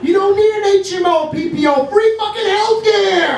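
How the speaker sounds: high-pitched, exasperated hollering; comic rant. Acted.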